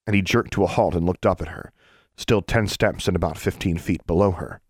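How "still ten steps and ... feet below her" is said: plain reading voice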